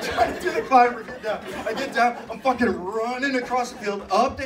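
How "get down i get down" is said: ordinary delivery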